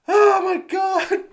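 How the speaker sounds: high, slow, drawn-out disbelief; incredulous wail. Real, not acted. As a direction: wail it high in exasperated amazement. Reacting to the absurd ending.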